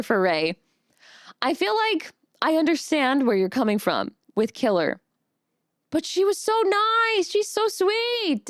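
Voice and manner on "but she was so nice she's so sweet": high, slow, gushing sweetness. Real, not acted. Streamer defending her friend from the killer vote, gushing. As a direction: melt over how sweet she was.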